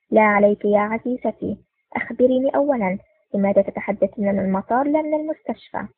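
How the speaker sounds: ordinary delivery, nothing marked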